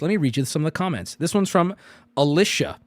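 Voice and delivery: usual speaking voice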